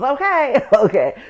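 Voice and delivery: a laughing, loud "Okay?" then "Okay". Real, not acted. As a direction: laugh it off loudly. Joking about depression running her over.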